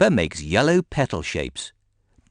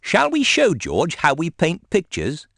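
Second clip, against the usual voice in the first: higher, lightly voiced teacher; Madame Gazelle asking; 9 semitones higher than usual. A narrator's reading. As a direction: invite the children kindly. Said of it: "Madame Gazelle asks the children".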